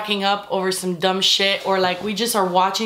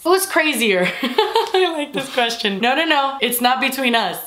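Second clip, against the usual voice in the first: usual speaking voice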